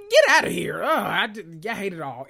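very high, loud cry of disbelief; exasperated outcry. Real, not acted. Disbelief that she competed on a broken leg, high loud cry. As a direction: screech it in outrage.

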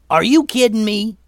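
high, loud outburst; outraged, full-on yelling; incredulous yell. Acted. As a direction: shout it in disbelief. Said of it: "yelled Momo".

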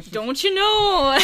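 slow, laughing Minnesota "Don't you know"; drawn-out Minnesota "Don't you know"; Minnesota accent. Retold or imitated. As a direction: ham up the Minnesotan drawl. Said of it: "cool Minnesota accent".

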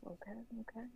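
very soft, retold giving in; meek compliance. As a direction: murmur a quick agreement. Giving in to the instructor.

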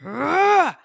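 loud, high frustrated groan; exasperated ugh. Retold or imitated. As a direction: let out a fed-up ugh. Overwhelming sense of frustration.

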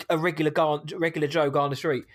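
ordinary delivery

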